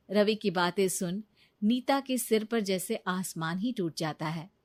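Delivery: plain reading voice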